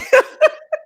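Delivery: shrieking laughter; big high cackle; loud high laugh. Real, not acted. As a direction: crack up loudly. Laughing at chat comment.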